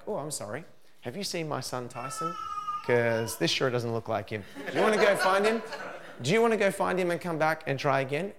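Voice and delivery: mock-sweet sarcasm, rising to a laughing high; sarcastic reply. Parent sarcastically sends rude son away, laughing. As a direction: tease him with fake politeness. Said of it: "I'm like".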